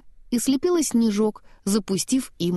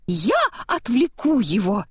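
volunteering; 3 semitones higher than usual. A narrator's reading. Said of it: "вызвался Олаф".

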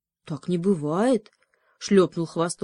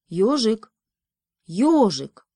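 louder, calling up to a sleeping friend; 3 semitones higher, 5 dB louder, slower than usual. Acted. Бобрёнок будит спящего ёжика, окликая его. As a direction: call him awake from outside. Beaver calls hedgehog awake.

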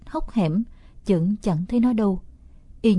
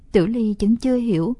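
plain reading voice

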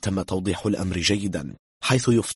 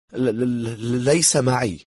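slow, higher, stuck on the first sound; nervous stammer; 5 semitones higher, 4 dB louder, much slower than usual. A narrator's reading. Cornered German says he lacks the ring, nervous stammer. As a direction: stutter badly before getting it out.

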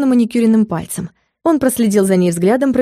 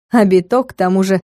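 usual speaking voice

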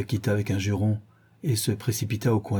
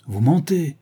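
louder, higher accusation; shouted; 5 semitones higher, 5 dB louder than usual. A narrator's reading. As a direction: shout it at her. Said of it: "cria James Vane".

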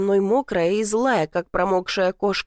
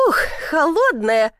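higher-pitched startled yelp of words; exclamation at the cold; 5 semitones higher than usual. A narrator's reading. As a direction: gasp out at cold water. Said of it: "раздался возглас".

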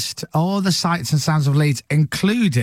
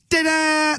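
high-pitched "da-da" flourish; sing-song high "da-da"; imitated fanfare; 14 semitones higher than usual. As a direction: mimic a scores fanfare, up high. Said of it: "He's going, and the scores are".

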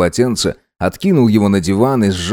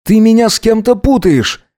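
much higher, indignant mild shout; calling after him; 12 semitones higher, 4 dB louder than usual. A narrator's reading. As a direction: call it out after someone leaving. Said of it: "крикнул ему вдогонку Гидеон". (крикнуть вдогонку)

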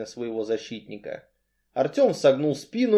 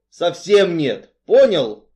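loud, slow «совсем нет»; emphatic refusal; 4 semitones higher, 8 dB louder, slower than usual. A narrator's reading. Artyom nudges Cain and insists, emphatically. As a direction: hammer it home loudly.

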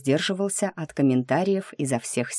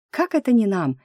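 surprised; 7 semitones higher than usual. A narrator's reading. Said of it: "чуть не ляпнула я".

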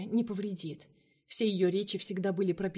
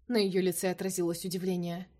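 ordinary delivery, nothing marked

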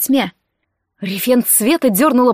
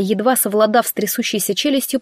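usual speaking voice

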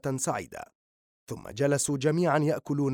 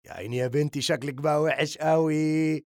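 mock pity. A narrator's reading. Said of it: "وبدأ الجميع في السخرية".

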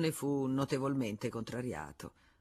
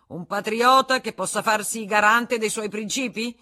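loud, raised demand; challenging; 6 semitones higher, 10 dB louder than usual. Acted. Senecal demanding a guarantor from the room, loud and raised. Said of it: "continuò Senecal".

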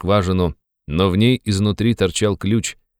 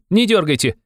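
high, excited youthful warning; urgent young man; 11 semitones higher than usual. A narrator's reading. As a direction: reason urgently, voice pitched high. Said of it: "закричал опять молодой человек". (взволнованный высокий молодой голос)

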